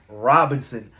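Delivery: usual speaking voice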